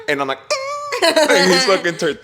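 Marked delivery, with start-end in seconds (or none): 0.4-1.0 thin high-pitched squeal; mock pained yelp. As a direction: squeal as if the harness pinches. Acts out yelp of harness pain.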